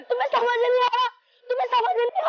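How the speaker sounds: high, upset outburst; exasperated protest. Acted. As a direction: throw it at them in desperation. Acted quarrel, girl protests being pulled.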